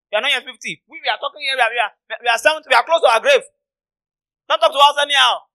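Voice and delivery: high, loud indignant scolding; outraged elders. Acted. Elders telling off young Jesus, outraged. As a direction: act out offended old men rebuking a youngster.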